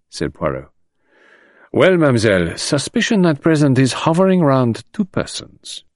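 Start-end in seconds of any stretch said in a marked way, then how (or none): 1.7-5.8 put-on high, measured French-accented detective. A narrator's reading. Detective confiding in an anxious girl, measured high character voice. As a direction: play a French-speaking detective, pitched up.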